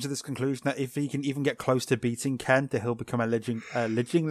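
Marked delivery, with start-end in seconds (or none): none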